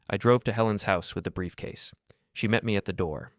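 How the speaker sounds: plain reading voice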